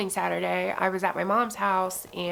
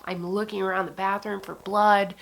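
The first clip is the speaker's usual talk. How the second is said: ordinary delivery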